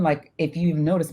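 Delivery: usual speaking voice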